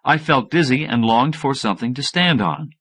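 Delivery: usual speaking voice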